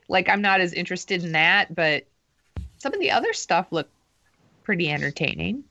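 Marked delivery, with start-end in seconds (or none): none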